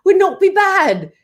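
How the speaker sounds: lisping British lad having a laugh; British accent. Pretend. One-fanged vampire jokes about Liverpool, lispy British accent. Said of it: "You, you got the British accent down".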